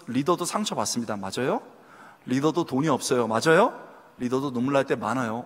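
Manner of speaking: ordinary talk, nothing marked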